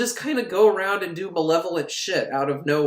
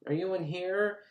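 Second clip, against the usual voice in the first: tentative searching call; 3 semitones higher, 8 dB quieter than usual. Retold or imitated. Todd searching room by room.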